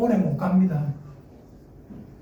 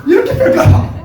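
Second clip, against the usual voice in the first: high, loud playful threat; mock threat; 6 dB louder than usual. Father pretending to scare his child, high loud mock threat. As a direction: ham up a father's fake threat.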